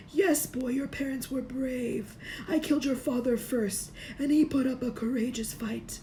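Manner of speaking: Dark Lord taunting Harry about his parents; Voldemort's taunt. A narrator's reading. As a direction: twist the knife gently.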